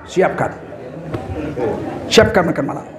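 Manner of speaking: a wife's soft submissive echo; quiet echo. Retold or imitated. Wife echoes order quietly.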